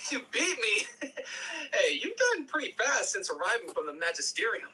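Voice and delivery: Gabriel, amused, laughing through it. Acted. Gabriel laughing after losing the race to Zion. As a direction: chuckle then praise her lightly.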